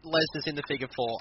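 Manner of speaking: usual speaking voice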